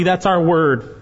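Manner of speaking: normal talking voice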